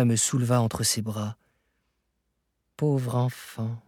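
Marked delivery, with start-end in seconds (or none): 2.8-3.8 slow, soft words full of pity; pitying murmur. A narrator's reading. Uncle to his exhausted nephew, pitying murmur. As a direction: murmur it slowly and tenderly. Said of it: "murmura-t-il avec un véritable accent de pitié".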